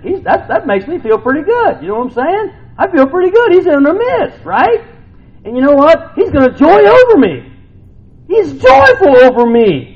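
high, loud joyful preaching; preaching at full force. Acted. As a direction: lift the crowd up with joy.

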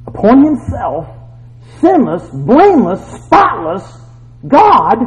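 rising, loud, word by word; building preaching. Real, not acted.

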